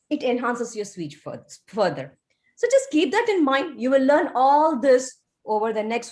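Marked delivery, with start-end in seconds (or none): none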